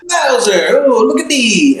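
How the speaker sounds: high, excited mock movie-star yell. Co-host mocking the casting of Bowser, high hammy actor voice.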